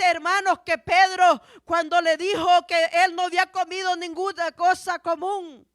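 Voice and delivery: insistent, projected sermon voice; preaching. Real, not acted. Preacher insists to brethren that God speaks through visions, forceful preaching. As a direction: press the point home to the congregation.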